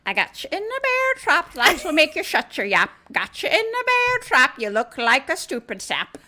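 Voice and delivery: high, loud sing-song leprechaun rhyme; leprechaun taunt. Retold or imitated. Leprechaun repeats his bear trap taunt, high and loud. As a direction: sneer the rhyme like a gleeful imp. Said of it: "That's what he does".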